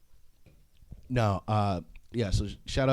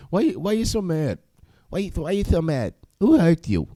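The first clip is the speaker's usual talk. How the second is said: higher put-on boxer voice, laughing at the end; Mike Tyson voice; 7 semitones higher than usual. Tyson asking Bill Burr why he's so mad, in a raised imitation voice. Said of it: "he has that voice".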